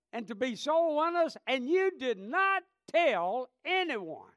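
high, loud, rising preacher's rebuke; preacher voicing Jesus rebuking a silent believer, loud and high; full-force preaching. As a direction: thunder it from the pulpit as the Lord.

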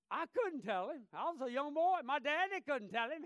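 normal talking voice

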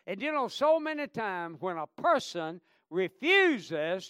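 loud, high preaching at full force; fiery preaching. Acted. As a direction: preach it out over the congregation.

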